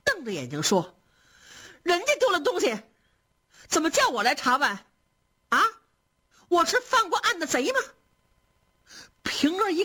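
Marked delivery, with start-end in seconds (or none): 1.8-7.8 high, heated protest; indignant outburst. A narrator's reading. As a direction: fling the accusation back, offended. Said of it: "急得脸都涨紫了，瞪着眼睛说". (涨红了脸，气急地高声质问)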